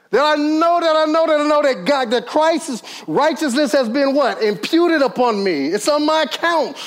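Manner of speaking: high, ringing preacher cadence; preaching at full force. Acted. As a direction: build the congregation up to a shout of faith. Preaching climax.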